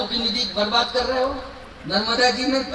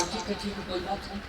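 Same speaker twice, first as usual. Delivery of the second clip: much softer and lower; placating agreement; 4 semitones lower, 14 dB quieter, faster than usual. Acted. Lord gives in to stubborn boatman, softly placating. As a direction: give in quietly, almost under the breath.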